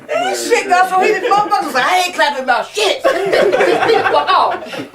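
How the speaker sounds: high, laughing through the words; cracking up at the silent crowd. Real, not acted. Host laughing that the audience forgot to clap.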